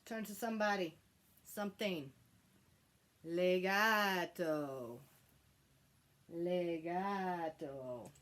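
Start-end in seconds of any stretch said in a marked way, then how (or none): 3.2-8.1 slow, drawn-out connected legato; smooth legato. Shown as a demonstration. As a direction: let it flow slowly. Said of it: "Everybody say legato".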